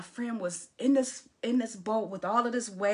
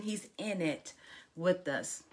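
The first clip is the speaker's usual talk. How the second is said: usual speaking voice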